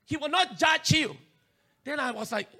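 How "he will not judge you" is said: high, shouted fake reassurance; mock preaching voice. Preacher shouting the fake godly inner voice. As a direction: proclaim it like a false prophet. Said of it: "And then say".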